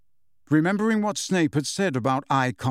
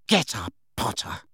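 Snape voice; 4 semitones lower than usual. A narrator's reading.

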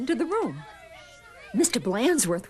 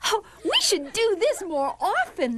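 put-on high, happy child voice; gleeful kids; 10 semitones higher than usual. A narrator's reading. Kids enjoying their free afternoon, gleeful. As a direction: chirp it like kids enjoying themselves.

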